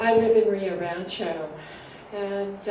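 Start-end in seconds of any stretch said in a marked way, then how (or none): 0.0-1.5 louder, projecting for the room; speaking up. Real, not acted. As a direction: raise your voice so everyone hears. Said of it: "Could you speak up".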